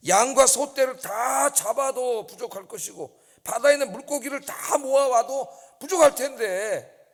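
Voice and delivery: slow, loud, rising sermon delivery; preacher building to a climax; fervent preaching. Acted. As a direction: press each phrase hard to the congregation. Preaching at full force.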